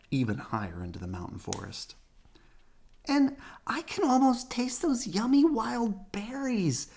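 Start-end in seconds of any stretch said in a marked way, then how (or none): 3.1-6.9 put-on high, sweet little girl bear; little Sister voice. A narrator's reading. Sister dreaming of berries on the drive, high and sweet. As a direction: savor the words like a treat. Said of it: "said Sister".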